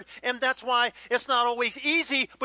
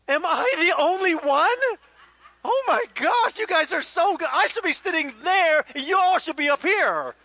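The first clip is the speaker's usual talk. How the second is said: laughing, higher and louder; laughing at the crowd; 5 semitones higher, 6 dB louder than usual. Real, not acted. Pastor laughing with the congregation, higher and louder. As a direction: bursts out laughing at the congregation's reaction.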